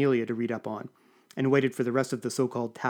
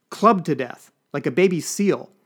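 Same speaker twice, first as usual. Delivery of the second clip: louder, higher shout; 3 semitones higher, 5 dB louder, slower than usual. Acted. Lucille repeats how Julian died, loud shout. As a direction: keep yelling the gruesome detail. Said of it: "she shouted".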